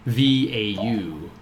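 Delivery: V A U spelled slowly, letter by letter; spelling it out. Shown as a demonstration. Game master spelling the name for players, slow letters.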